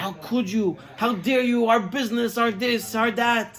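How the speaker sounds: a touch of a furious wife; mild mock yell. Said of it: "she starts screaming".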